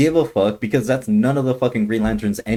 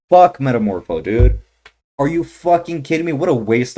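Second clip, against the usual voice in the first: much louder, then higher in disgust; frustrated outburst; 5 semitones higher, 6 dB louder than usual. Real, not acted. Venting to viewers that it was Metamorpho, frustrated outburst. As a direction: vent the disappointment. Annoyed at the reveal.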